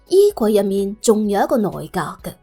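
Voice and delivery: ordinary delivery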